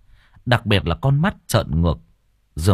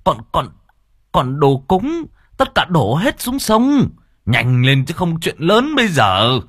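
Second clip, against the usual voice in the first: loud, high, breathless warning; urgent alarm; 7 semitones higher, 4 dB louder than usual. A narrator's reading. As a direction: shout the news in a panic. Villager runs in with urgent news. (giọng hốt hoảng gấp gáp báo tin)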